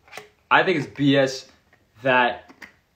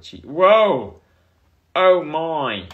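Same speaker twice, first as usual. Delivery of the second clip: sudden loud startled whoa, pitched up; startled gasp; 4 semitones higher, 8 dB louder, much slower than usual. Real, not acted. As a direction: jump at the glare. Startled by phone brightness.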